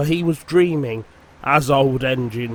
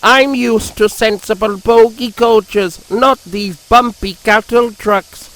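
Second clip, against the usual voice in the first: higher, sniffy grumble; proud engine grumbling at his new shed; 6 semitones higher, 4 dB louder than usual. A narrator's reading. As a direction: complain about the shed. Sir Handel complaining.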